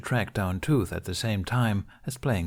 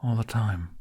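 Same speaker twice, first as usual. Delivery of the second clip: a touch of longing, understated; wistful; 2 semitones higher than usual. Acted. Jack admits to Hiccup he misses home, wistful. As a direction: let the homesickness slip through. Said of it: "He couldn't stop how wistful he sounded".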